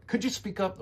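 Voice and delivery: high-pitched, pushy request; aggressive. Shown as a demonstration. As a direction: demand it impatiently, pitched well up. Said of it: "aggressive things we don't wanna say".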